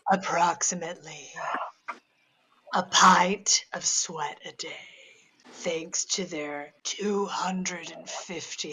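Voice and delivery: slow, low breathy whisper; sexy voice. Shown as a demonstration. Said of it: "I'm gonna go in a different direction with my sexy voice".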